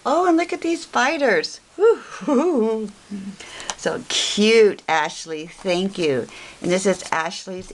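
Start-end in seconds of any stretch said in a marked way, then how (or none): 1.8-2.9 playful ghostly woo-hoo; a mock-spooky hoot for the spider card; lightly hammed Halloween woo.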